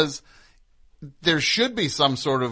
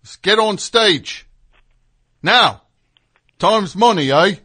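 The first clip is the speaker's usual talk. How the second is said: bossy English bandmate, building louder; 4 semitones higher, 5 dB louder than usual. Acted. Drummer ordering his band on stage, bossy and increasingly loud. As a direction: crack the whip in an English accent. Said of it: "he was kind of the whip cracker in Led Zeppelin".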